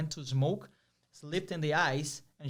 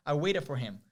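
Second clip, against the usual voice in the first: usual speaking voice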